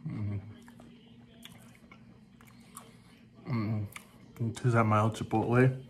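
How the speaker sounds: talking while chewing; savoring food mid-sentence; mouth partly full. Real, not acted. Seen 1: Chip eaten just before; jaw still chewing, dip held until 1.3s.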